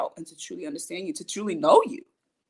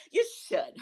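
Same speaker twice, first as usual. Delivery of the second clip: much higher, squeaky little repeat of "You should"; squeaky high echo. Pretend. Egging herself on to speak up, in a squeaky high voice. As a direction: pop it up high like a tiny cheering voice. Answering her own imagined question.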